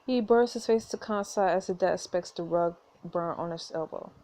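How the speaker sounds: ordinary talk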